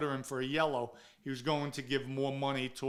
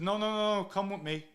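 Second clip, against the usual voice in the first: insistent no; 6 semitones higher, faster than usual. Retold or imitated. To Frankie refusing the Coke, pressing urgent no. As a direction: wave off the offer, pressing him.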